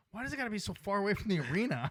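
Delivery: whiny complaint. Retold or imitated. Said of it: "He's like".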